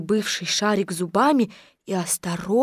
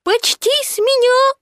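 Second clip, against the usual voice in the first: high, squeaky little creature voice; small Piglet; 13 semitones higher, 3 dB louder than usual. Acted. Piglet answers the donkey about the balloon, high and squeaky. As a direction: pipe up high and loud, stretched.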